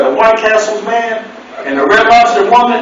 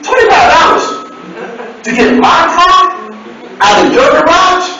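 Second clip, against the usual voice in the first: outraged shout; 9 semitones higher than usual. Retold or imitated. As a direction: bellow it in disbelief at the price. Outraged at garage fee.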